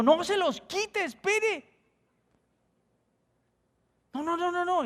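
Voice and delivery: very high, slow, begging no; pleading stop. Retold or imitated. Pastor begs stranger not to remove his shoes, very high. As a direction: stretch it out high to stop him.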